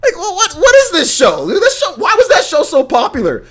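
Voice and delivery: high, loud, laughing disbelief; can't believe this show was popular; exasperated disbelief. Real, not acted. Incredulous rant about the show.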